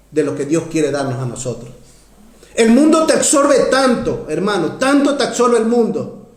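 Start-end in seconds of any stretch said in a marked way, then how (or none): 2.5-6.3 high, emphatic pulpit warning; impassioned sermon delivery; fervent preaching. Real, not acted. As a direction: warn the congregation with force. Preaches at full force.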